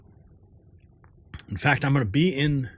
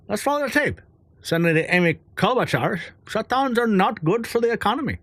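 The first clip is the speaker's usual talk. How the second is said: keeps up the put-on Indian accent, pitched higher; comic Indian accent all the way to the quote; playful Indian accent read; 6 semitones higher than usual. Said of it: "[name] sounds more Indian than Ireland".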